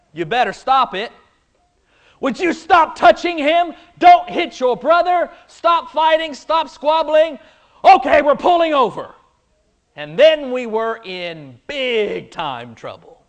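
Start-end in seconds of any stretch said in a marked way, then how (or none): 2.2-9.1 high, raised scolding mother; scolding parent. Retold or imitated. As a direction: nag the kids from the front seat. Parent scolding kids in car.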